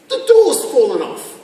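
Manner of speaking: lightly indignant customer bringing back a faulty car; mock customer complaint. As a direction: raise the voice in mild acted outrage. Acted customer complaining at showroom.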